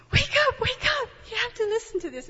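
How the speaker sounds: high, rushed "wake up"; excited urging. Retold or imitated. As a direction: rouse him with urgent delight. Waking her husband excitedly.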